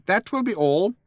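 high mouse voice, firm; firm dismissal. A narrator's reading. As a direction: dismiss him firmly, pitched high. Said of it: "said Benji firmly".

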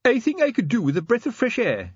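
high-pitched, pained complaint; groaned words. A narrator's reading. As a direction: groan it, wanting out. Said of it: "he groaned".

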